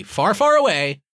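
far away swooping high and loud; dramatic flourish. Pretend. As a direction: sweep up grandly on far away. Star Wars catchphrase flourish.

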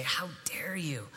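a retold furious line, whispered low and slow; angry whisper. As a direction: hiss it back as she said it, but only in a whisper. Red-faced girl confronting him.

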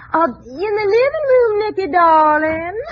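a hint of a Southern belle accent; Southern visitor. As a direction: play the sweet Southern visitor. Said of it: "I'll be a visitor from the South".

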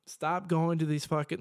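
ordinary delivery

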